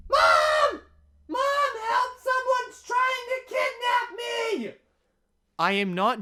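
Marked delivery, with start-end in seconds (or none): 0.1-4.7 high-pitched scream for mom; screaming child. Retold or imitated. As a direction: scream it as a panicked kid crying for help. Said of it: "I'm gonna scream here".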